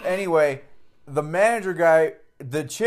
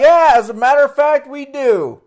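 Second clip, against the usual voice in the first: high, loud sarcastic laughing answer; smug mock politeness; 9 semitones higher, 8 dB louder than usual. Sarcastic reply to the cashier, acted out.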